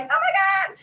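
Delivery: high mock-thrilled exclamation; retold excited gasp. Shopper reliving seeing it in the store, excited. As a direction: re-enact your delighted reaction. Retelling own reaction on seeing it.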